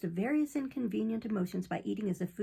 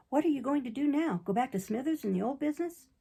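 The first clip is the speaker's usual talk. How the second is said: ordinary delivery, nothing marked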